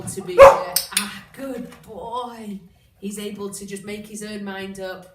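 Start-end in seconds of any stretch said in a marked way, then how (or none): 0.0-2.6 gushing dog praise; excited praise. Real, not acted. Praising dog while explaining.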